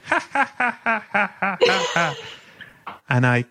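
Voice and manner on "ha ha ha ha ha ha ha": high, repeating canned ha-ha like a laugh box; mechanical laugh. Retold or imitated. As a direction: play a wind-up laugh machine, evenly spaced. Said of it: "and you're".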